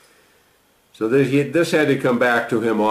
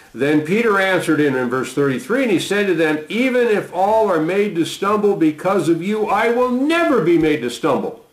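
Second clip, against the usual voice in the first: raised pitch, earnest reading of Peter's vow; scripture reading; 9 semitones higher than usual. Acted. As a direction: lift the voice to read Peter's bold promise. Reading Peter's boast aloud.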